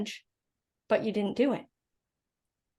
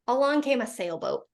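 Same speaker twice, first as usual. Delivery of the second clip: ordinary talk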